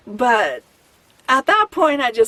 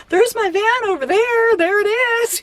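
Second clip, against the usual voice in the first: high, eager pointing out, much higher; 11 semitones higher than usual. Retold or imitated. Retelling showing a stranger her van, eager and much higher. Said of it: "and I showed her, I'm pointing".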